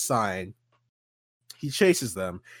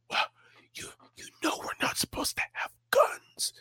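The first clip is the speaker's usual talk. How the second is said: high, whispery, tearful squeak; timid Arthur voice. Acted. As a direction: squeak it out nervously. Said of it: "And then Arthur's like".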